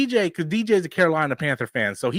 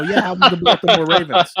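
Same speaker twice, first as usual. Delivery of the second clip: louder, laughing taunt with a grin; mocking gloat; 3 semitones higher, 7 dB louder than usual. Texting Danny a gloating jab about Baltimore, loud and laughing. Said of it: "message him last night and go".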